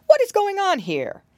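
loud, high-pitched astonished question; surprised cry. A narrator's reading. Old gentleman rabbit walks in on the fuss, crying out. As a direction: cry out wanting to know what's up. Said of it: "he cried".

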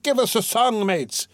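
high, rousing call for music; shouted. A narrator's reading. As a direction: call out to the mates in the cart. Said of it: "shouted someone in the cart".

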